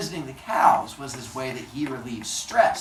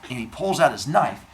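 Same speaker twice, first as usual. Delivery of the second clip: usual speaking voice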